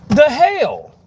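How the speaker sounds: high, loud "the hell?"; exasperated outburst. Real, not acted. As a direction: blurt it out in disbelief. Venting about the moved seat.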